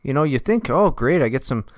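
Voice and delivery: a folksy Canuck chatting, bud; mild mock Canadian accent on request. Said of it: "How's that?".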